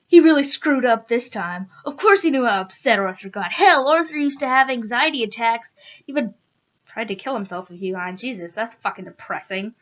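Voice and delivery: plain reading voice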